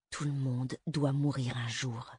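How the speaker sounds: low, slow, through clenched teeth; a mother muttering grimly through her teeth; through gritted teeth. A narrator's reading. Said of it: "disait sa mère entre ses dents". (entre les dents, lent et sourd)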